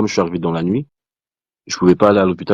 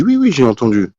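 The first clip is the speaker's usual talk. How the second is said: forced cheerful yes; 6 semitones higher, 4 dB louder than usual. Retold or imitated. Calm reply to doctor's bad news.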